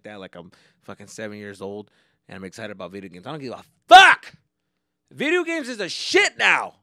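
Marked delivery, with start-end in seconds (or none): 3.3-6.8 much louder and higher, daring anyone to judge; defiant shout. Real, not acted. Gamer defending his hobby against judgment, defiant shout. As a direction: yell it back at the doubters. Defiant rant about video games.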